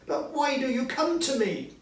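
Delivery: high, loud frantic agreement; frightened Scrooge. Acted. Scrooge yielding to the ghost, high and scared. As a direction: give in fast, terrified.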